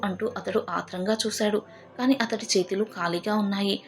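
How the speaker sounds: usual speaking voice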